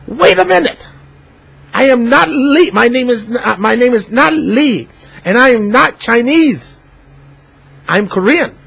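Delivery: high, heated correction, not Chinese; indignant protest. Retold or imitated. Uncle protesting to the customer, high and indignant. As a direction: insist hotly that he is Korean. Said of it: "He said".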